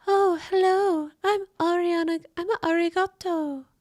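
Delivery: mock her cutesy high voice. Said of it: "she talks like this".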